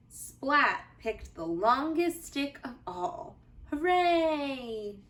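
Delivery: put-on gentle, lively read-aloud for children; cozy storybook narration for little listeners; bedtime story reading. A narrator's reading. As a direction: read it like a bedtime picture book.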